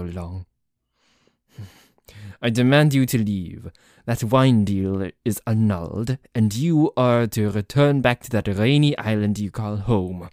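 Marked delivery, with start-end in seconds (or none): 2.4-10.3 raised, commanding voice; indignant, forceful dismissal; angry demand. Acted. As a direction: order a rival out loudly and firmly. Orders rival out, acted quarrel.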